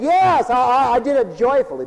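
high, loud, bright eager answer; eager joyful yes. Retold or imitated. Preacher recalling answering his teacher, eagerly joyful. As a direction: burst out with a glad yes.

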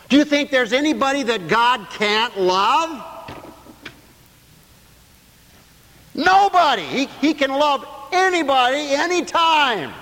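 high, drawn-out preacher's cadence; full-voiced preaching. Real, not acted. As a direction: preach it out, stretching the phrases high. Preaches emphatically.

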